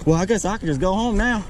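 ordinary talk, nothing marked

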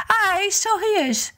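high, lilting Irish wife; Una's voice. Acted. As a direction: sweetly put off the visitor in a high voice. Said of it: "she said".

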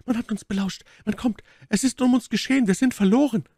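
high, alarmed lady's voice; panic. Acted. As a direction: rise to a high cry of doom. Milady alarmed at being overheard. (hohe, erschrockene Frauenstimme in Panik)

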